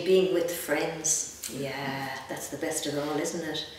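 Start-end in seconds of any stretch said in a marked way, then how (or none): none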